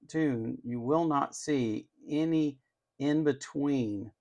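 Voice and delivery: ordinary talk, nothing marked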